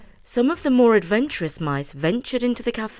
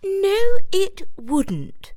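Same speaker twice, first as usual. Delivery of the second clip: high, firm mouse voice; a clever mouse setting them straight; Judith, know-it-all; 13 semitones higher, 8 dB louder, much slower than usual. A narrator's reading.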